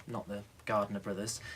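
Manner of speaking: usual speaking voice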